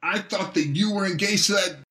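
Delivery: high, strained furious voice; losing his temper. A narrator's reading. Betrayed husband confronting his wife's lover, high and furious. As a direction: rage pouring out at his rival. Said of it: "now losing his temper".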